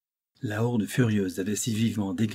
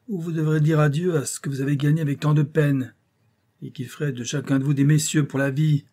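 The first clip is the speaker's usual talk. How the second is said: raised, insistent captain's harangue; captain haranguing his wavering men; stern captain; 5 semitones higher, 5 dB louder than usual. A narrator's reading. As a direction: press the crew with an ultimatum.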